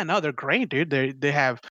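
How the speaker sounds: normal talking voice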